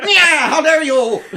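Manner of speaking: slow, very loud and high villain shout through laughter; Skeletor villain voice. Retold or imitated. Gamer imitating Skeletor taunting heroes, laughing loud. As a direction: gloat like a cartoon overlord, laughing. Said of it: "I turned into Skeletor playing that game".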